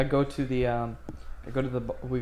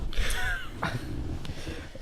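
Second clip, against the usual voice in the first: airless wheezing giggle; breathless laugh; 4 dB quieter than usual. Real, not acted. Player trying to get back into the game, wheezing with laughter.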